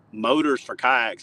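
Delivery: slow, doubtful repeat of motors for kayaks; skeptical echo. Retold or imitated. [name] doubts the kayak motor pitch, skeptical. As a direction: scoff at the idea, plain voice. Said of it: "And I was like".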